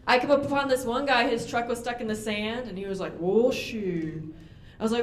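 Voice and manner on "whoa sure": lightly imitated Outer Banks drawl, Whoa shoe; a hint of a thick coastal accent; mock local drawl. Truck guy stuck in sand.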